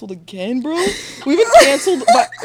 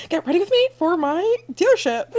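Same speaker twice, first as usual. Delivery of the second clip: influencer voice; 9 semitones higher than usual. Retold or imitated. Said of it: "so I was like".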